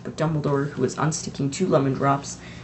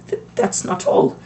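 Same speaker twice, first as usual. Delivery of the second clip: trembling voice; 6 semitones higher, 3 dB louder than usual. A narrator's reading. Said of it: "McGonagall's voice trembled".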